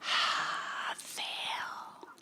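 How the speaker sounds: unvoiced whisper; whispered scoff. The original instructor returning, a whispered fail. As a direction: breathe the word out dismissively. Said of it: "instructor came back going".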